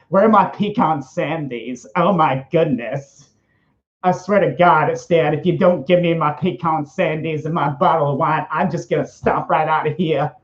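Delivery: lispy, campy cartoon diva; put-on petulant lispy voice; Roger the Alien. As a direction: complain fussily in a camp lisp, stretching the first words. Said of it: "I sound like Roger the Alien".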